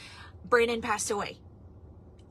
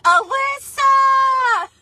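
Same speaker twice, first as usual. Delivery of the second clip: much higher, loud, stretched greeting; excited call; 14 semitones higher, 9 dB louder, much slower than usual. Retold or imitated. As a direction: call her name out like a thrilled friend. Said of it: "he actually FaceTimed me and he was like".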